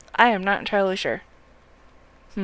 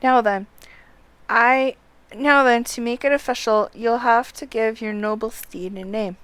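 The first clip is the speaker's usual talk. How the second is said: louder, higher, formal announcing delivery; game dialogue read aloud; 3 semitones higher, 7 dB louder, slower than usual. Acted. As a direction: read it out like an official handing over a steed. Reads game character's dialogue aloud.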